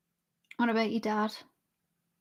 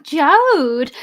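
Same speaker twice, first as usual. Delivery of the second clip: louder drawn-out name call, swooping up then down; sister telling off; 3 semitones higher, 8 dB louder than usual. Acted. Streamer mimicking her sister's telling-off, drawn-out scolding shout. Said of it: "when she's telling me off or something".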